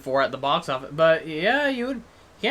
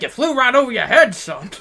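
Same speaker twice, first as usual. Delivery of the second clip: higher, louder swooping Southern rooster voice; Foghorn Leghorn; 5 semitones higher, 5 dB louder than usual. Acted. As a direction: bluster it out with big pitch swings, dropping on "son". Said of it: "they insert Foghorn into different".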